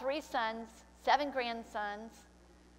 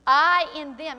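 loud, ringing declaration of I in them; proclaimed loudly; 3 semitones higher, 13 dB louder than usual. Shown as a demonstration. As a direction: call out I in them with force. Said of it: "He said it over and over again".

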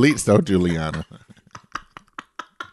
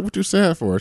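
incredulous retort; 10 semitones higher than usual. Retold or imitated. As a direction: toss it back like it's absurd. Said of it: "Why do you say it like that?".

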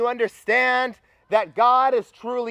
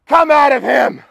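loud, high exorcising shout; stern command; 6 semitones higher, 10 dB louder than usual. A narrator's reading. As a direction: bellow a stern order at the demon. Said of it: "And Jesus said sternly".

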